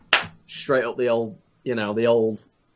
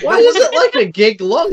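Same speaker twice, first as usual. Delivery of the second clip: mock outrage; 9 semitones higher, 6 dB louder than usual. Mock outrage echoing friend.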